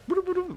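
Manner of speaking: a squeaky high chant of nonsense syllables; high sing-song boo-doo. Retold or imitated. Guide leading through the jungle, squeaky high chant. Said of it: "he's just like".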